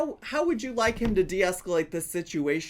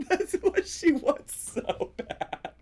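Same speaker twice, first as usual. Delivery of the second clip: slower, higher laughing repeat; higher, slower, helpless laughter; 8 semitones higher, slower than usual. Real, not acted. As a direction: squeal it out between laughs.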